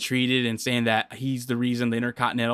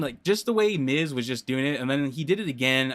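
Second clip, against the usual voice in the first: normal talking voice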